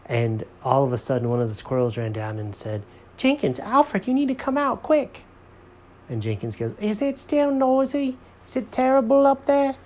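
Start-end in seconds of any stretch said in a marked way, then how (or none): 3.2-5.2 high-pitched little squirrel voice; urgent squirrel. A narrator's reading. 6.8-9.8 very high, complaining cartoon voice; fussy Jenkins character. A narrator's reading.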